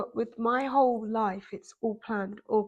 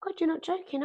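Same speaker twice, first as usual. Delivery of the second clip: amazed; 7 semitones higher, 3 dB quieter than usual. Retold or imitated. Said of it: "I was like".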